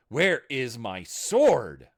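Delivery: slow, very loud demand; shout. A narrator's reading. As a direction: roar the question slowly. Urgent demand.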